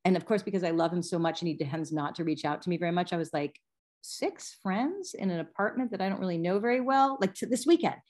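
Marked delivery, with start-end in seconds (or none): 4.0-8.0 mildly incredulous, pitched up questioning; incredulous retelling. Retold or imitated. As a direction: replay a touch of disbelief at the request. Retells her reaction to son's request.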